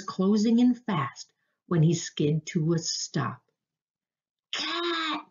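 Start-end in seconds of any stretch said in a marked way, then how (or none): 4.5-5.2 high, held-back yell of warning; alarmed yell. A narrator's reading. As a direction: sound the alarm in a high, thin-volume cry. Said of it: "he yelled".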